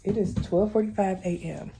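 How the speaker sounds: normal talking voice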